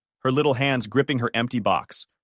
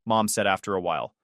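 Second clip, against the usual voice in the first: plain reading voice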